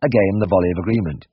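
ordinary delivery, nothing marked

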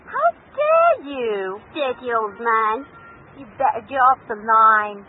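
outraged, slow and cutting, then loud; indignant, telling off an intruder; angry. Acted. Stranger rebukes a caller who cut in.

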